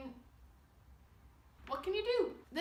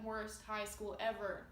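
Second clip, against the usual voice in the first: unimpressed verdict; slower than usual. Acted. Reading a one-star school review, slow unimpressed delivery. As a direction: drag it out without any cheer.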